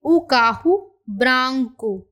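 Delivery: o carro branco, slowly, carro as kahu; slow clear Portuguese phrase; model phrase. Shown as a demonstration. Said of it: "तो हम क्या बोलेंगे?".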